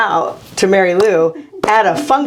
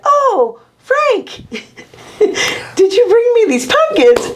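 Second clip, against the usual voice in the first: very high, delighted-wife imitation; Mary Lou; 14 semitones higher, slower than usual. Wife finding pumpkins on the porch, gushing imitation. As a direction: coo it as a wife finding a gift, pitched way up. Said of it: "Mary Lou was like".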